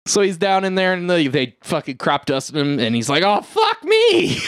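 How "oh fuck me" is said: mock anguished cry. Crop dusted in the corn.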